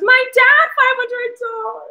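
high, tearful, barely holding it together; choking up reading her dad's name; tearing up over a family donation; voice breaking. Real, not acted. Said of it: "I'm gonna cry".

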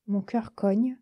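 usual speaking voice

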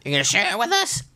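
high, quick goblin voice. Acted. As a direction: squeak it out like a goblin. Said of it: "not goblin voice".

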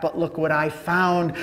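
normal talking voice

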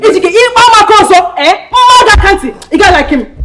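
loud taunt. Acted. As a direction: jeer at him loudly this time.

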